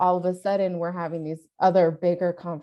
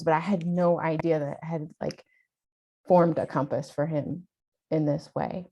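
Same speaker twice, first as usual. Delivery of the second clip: normal talking voice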